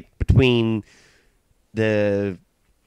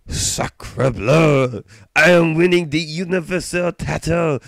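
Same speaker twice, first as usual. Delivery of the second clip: high-pitched hammed-up French accent; 6 semitones higher, 3 dB louder, slower than usual. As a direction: do an over-the-top French Canadian wrestler. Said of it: "I like doing a French accent".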